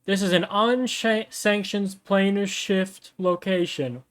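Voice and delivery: high, slow official announcement; megaphone announcement. Acted. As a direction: announce it slowly through a megaphone. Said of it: "a megaphone behind the back".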